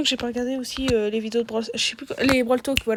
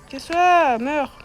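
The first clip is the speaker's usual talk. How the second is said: yelling; 5 semitones higher, 4 dB louder, much slower than usual. Real, not acted. Yelling at enemy in game.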